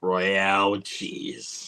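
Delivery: very slow, low delivery; slow, savored punchline; drawn-out movie quote. Acted. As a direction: stretch each word out deliberately. Delivering the famous film line.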